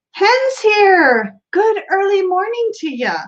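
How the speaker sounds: greeting a newcomer in a mock Irish brogue; Irish accent. Host greets Hen in a light mock Irish accent. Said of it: "I just went Irish on you".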